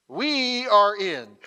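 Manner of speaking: loud, with "We" drawn out long; emphatic WE. Shown as a demonstration. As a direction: lean hard and slowly on "We". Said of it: "Did you hear my emphasis there?".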